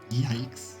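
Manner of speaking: normal talking voice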